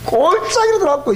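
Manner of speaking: ordinary talk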